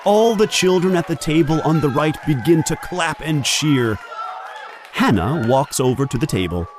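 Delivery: plain reading voice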